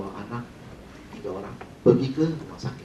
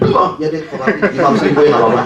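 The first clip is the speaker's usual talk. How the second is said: loud mock giving-in, higher pitch; persetujuan spontan; 4 semitones higher, 8 dB louder than usual. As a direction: act out a customer suddenly caving, loudly. Pembeli langsung setuju, ditirukan. (pura-pura langsung setuju dengan lantang)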